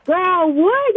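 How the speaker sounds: ordinary delivery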